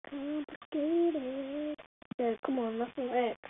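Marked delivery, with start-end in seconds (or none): none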